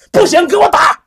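loud, high shouted command; shouted order. Acted. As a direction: order the beating at full voice. Manager orders the beating.

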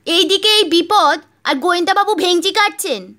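high, loud scolding wife. Acted. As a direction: scold him sharply. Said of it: "চাপা তর্জন গর্জন".

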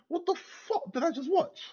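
can't believe what you just saw; incredulous outburst. Real, not acted. Fan venting at the team's draw, disbelieving cry. Fan ranting after bad match.

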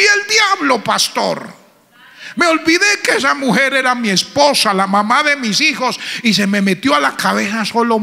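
high, loud building sermon; full-force pulpit retelling; fiery preaching. Acted. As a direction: preach it with rising fire.

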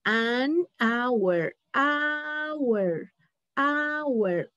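"hour" said as "awer" with a silent h; teacher drills "An hour" for students to hear; model pronunciation. Shown as a demonstration. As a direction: repeat "hour" with the h dropped. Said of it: "Y aquí escucha".

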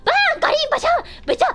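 loud, high crash noises; 擬音の実演. Acted. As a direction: act out things smashing, sound by sound. 戦闘で割れる音の再現. (割れて飛び散る音を大げさに口で再現)

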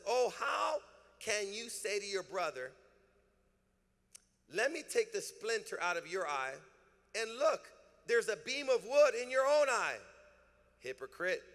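loud, rising preacher's reading of scripture; preaching scripture. Acted. Pastor reading verse four to the congregation, fervent and loud. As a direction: preach it out, building fire.

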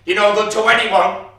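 very loud, high shouted order; furious shout. Acted. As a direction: throw him out with your voice. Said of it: "She says".

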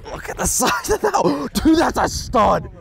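high, breathless excitement with a gasp; thrilled. Real, not acted. Excited over a bigger fish.

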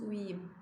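uyuyayım said as uyuyım; spoken form. Shown as a demonstration. Teacher models the spoken form, uyuyım. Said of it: "diye okuyoruz".

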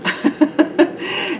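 high bright giggle at her own pun; a quick high laugh. Real, not acted. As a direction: giggle at the gourd joke.

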